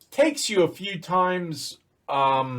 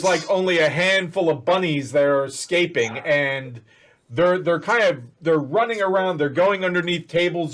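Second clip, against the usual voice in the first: ordinary talk, nothing marked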